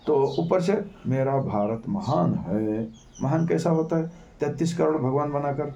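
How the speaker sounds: ordinary talk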